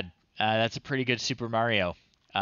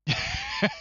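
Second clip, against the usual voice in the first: a sudden high-pitched burst of laughter. Real, not acted. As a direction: laugh out at the funny picture. Laughs at the drawing.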